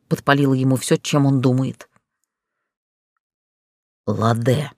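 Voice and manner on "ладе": low, restrained name through the teeth; through clenched teeth. A narrator's reading. Director addresses the fallen heroine, low through his teeth. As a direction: lower and tightly controlled. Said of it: "процедил он".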